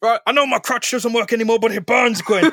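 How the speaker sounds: high, fast comic complaint; pained old dad. Acted. Scalded father complains to his daughter, high mock distress. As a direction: pitch it way up as a mock-hurt dad.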